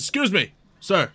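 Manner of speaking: lightly voiced guard hailing someone; a hint of an official flagging a problem. Guard to the captor about a loose prisoner, mildly official.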